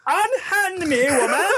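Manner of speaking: put-on very high, haughty British baby; British-accented baby. Baby ordering the nanny off, high British accent. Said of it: "busted out with this British accent".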